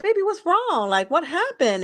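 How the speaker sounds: put-on reach out gently to a crying child; calling a crying child baby, tender and worried; tender concern. Comforting her crying granddaughter.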